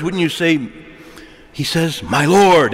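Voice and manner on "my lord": "My Lord" louder, weight on "Lord"; "My Lord" said firmly, the word "Lord" stressed; emphatic singular Lord. Shown as a demonstration. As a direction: lean on "Lord" to show it is one. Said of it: "in the singular".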